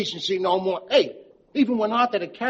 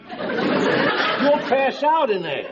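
very slow, pitched up, ominous repeat; drawn-out warning; 4 semitones higher, much slower than usual. Acted. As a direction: stretch out the warning word by word.

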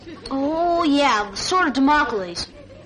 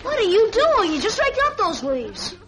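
surprised; 8 semitones higher than usual. Acted. Surprised at Jeff raking leaves.

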